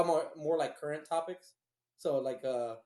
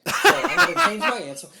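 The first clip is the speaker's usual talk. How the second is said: laughing; 9 semitones higher, 11 dB louder than usual. Real, not acted. Joking after sounding like a dick.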